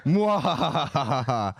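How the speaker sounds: slow, hammed-up villain cackle; evil mock cackle. Evil laugh in retold chat.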